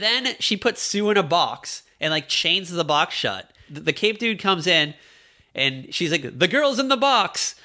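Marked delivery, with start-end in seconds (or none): 6.4-7.5 higher, breezy tattletale; mock betrayal. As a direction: sell her out cheerfully, pitched up. Woman betrays Sue, voiced.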